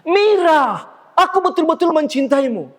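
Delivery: lantang dan jauh lebih tinggi, diproyeksikan ke penonton; suara panggung. Shown as a demonstration. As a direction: deklamasi aktor panggung agar terdengar sampai belakang. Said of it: "Suara kita harus didengar oleh penonton". (suara panggung teatrikal, tinggi dan lantang)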